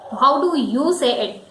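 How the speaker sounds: ordinary talk, nothing marked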